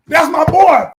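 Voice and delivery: high, loud cheering shout; mock sideline shout of pride; proud cheer. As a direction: yell it from the couch like a proud dad. Voicing himself cheering on TV.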